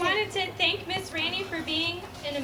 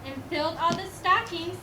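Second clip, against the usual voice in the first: steady storybook recitation; poem reading; 3 semitones higher than usual. Acted. Reading the poem to the school audience, storybook recitation. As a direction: read the old Christmas poem aloud. Reads Christmas poem aloud.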